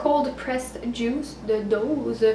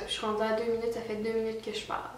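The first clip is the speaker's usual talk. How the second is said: normal talking voice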